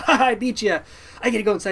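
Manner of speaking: high, loud victory taunt; gloating. Retold or imitated. Kid who just beat uncle in a race, gleeful gloating. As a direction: crow in triumph at beating them.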